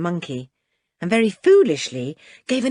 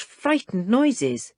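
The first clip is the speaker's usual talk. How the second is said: usual speaking voice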